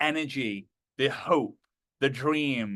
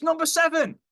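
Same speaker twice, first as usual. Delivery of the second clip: high-pitched call-out; mock photo-booth caller; 3 dB louder than usual. Someone at the ride exit calling photos, high mock voice. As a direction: pipe it out in a put-on high voice. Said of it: "someone says".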